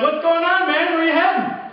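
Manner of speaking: high, sing-song mock friendliness; fake friendly. DJ greeting the fleeing promoter, high fake-friendly voice. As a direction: sweeten it like a trap.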